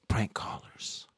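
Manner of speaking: slow whisper to himself; hushed, amused whisper; whispered aside. Real, not acted. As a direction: breathe it out under your breath. Muttered aside after a prank call.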